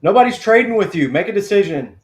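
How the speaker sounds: loud, high, impatient yell at the TV; impatient shout. Real, not acted. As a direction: yell at the team to hurry. Impatient fans yelling at draft.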